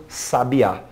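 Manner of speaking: slow "sabiá", stressed sa-bi-Á; stress on last syllable. Shown as a demonstration. Teacher contrasts accent placement, stress on last syllable. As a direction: land on the final Á of "sabiá". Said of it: "aquela sílaba tem mais força do que outras".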